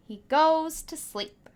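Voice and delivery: ordinary delivery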